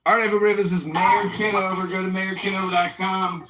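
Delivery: politician ad voice. Acted. Mock mayoral ad.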